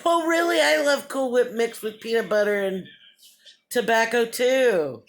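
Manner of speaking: high, gushing fake enthusiasm; put-on polite excitement; pretend interest. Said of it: "But you have to pretend".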